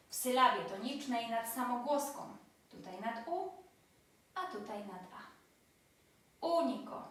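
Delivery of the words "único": único with a high Ú, then falling; único, accented third syllable from the end; stress on first syllable. Shown as a demonstration. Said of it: "Trzecia sylaba od końca".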